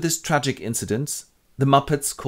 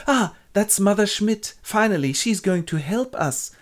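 relieved mother; 11 semitones higher than usual. Acted. Mother greets the helper.

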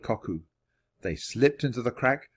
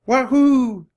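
very high, loud baboon whoop; imitated baboon cry, held and falling; baboon call; 14 semitones higher, 9 dB louder than usual. A narrator's reading. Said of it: "he heard another sound".

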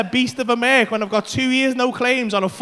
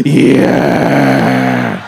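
very low, loud drawn-out yeah; defiant mock roar; 8 dB louder than usual. Answering a friend's dare at the car, defiant mock roar. Acts bold reply to a dare.

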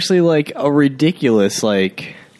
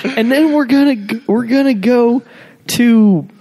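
mock excited dad; 8 semitones higher than usual. Dad planning movie night.

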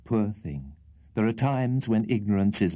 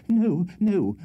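ordinary delivery

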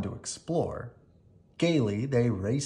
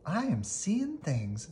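frightened squeak; 3 semitones higher, slower than usual. A narrator's reading. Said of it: "squeaked Annie suddenly".

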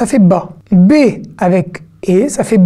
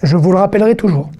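ordinary talk, nothing marked

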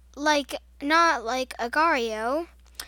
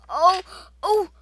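panicked; 6 semitones higher, 9 dB louder than usual. Real, not acted. About to be killed.

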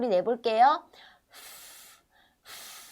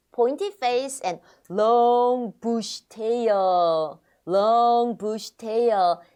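slow model phrase; 4 dB louder, much slower than usual. Shown as a demonstration. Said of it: "slow modeled phrase".

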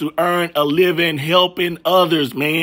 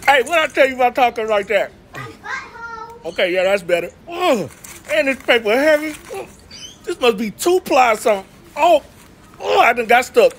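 struggling bird voice; 6 semitones higher than usual. Acted. Voicing bird carrying toilet paper.

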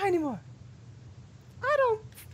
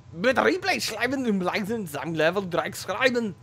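German reading; 5 semitones higher, 4 dB louder than usual. Pretend.

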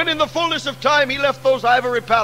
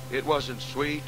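soft, low, sorrowful preaching refrain; hushed refrain; 6 semitones lower, 8 dB quieter, slower than usual. Acted. Hushed refrain before rising repeat.

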